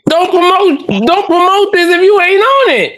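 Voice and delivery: angry. Real, not acted. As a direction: yell it in outrage. Angry rant about poster.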